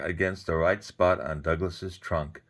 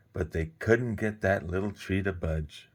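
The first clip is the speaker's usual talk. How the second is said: plain reading voice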